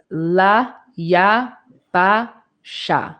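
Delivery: slow, each syllable separate. Shown as a demonstration. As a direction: break the word into syllables slowly. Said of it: "really syllable by syllable".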